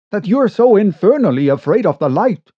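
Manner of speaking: fast, high, loud exasperated question; bursting out. Acted. Narrator questions the boatman's fear, bursting out. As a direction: fire it at the stranger impatiently. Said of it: "I burst out".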